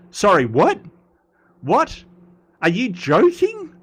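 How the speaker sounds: high-pitched incredulous questions; mock disbelief. Streamer reacting to Americans calling Cockney posh, mock disbelief. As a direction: react as if you can't believe what you heard.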